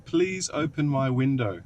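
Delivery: slowly drawn-out, careful request; slow, deliberate command. Real, not acted. Repeating for the unresponsive car, slow deliberate command. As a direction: spell it out slowly for a machine.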